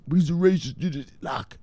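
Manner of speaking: high, put-on protesting voice; mock objecting viewer. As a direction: imitate a viewer whining an objection.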